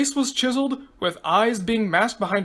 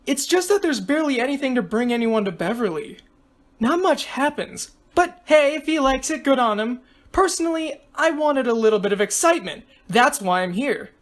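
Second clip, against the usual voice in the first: put-on higher girlish voice, chatty; excitable girl contestant, explaining; 4 semitones higher than usual. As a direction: explain in a raised, lively girl's voice.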